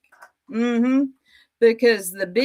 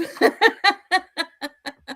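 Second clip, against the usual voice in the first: high mocking cackle; high mocking laugh; 9 semitones higher than usual. Real, not acted. Laughs at opponent.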